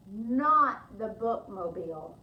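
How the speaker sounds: very slow, weighted bookmobile; slow down for the joke; teasing punchline. Acted. Joking warning punchline.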